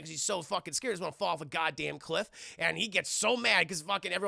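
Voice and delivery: usual speaking voice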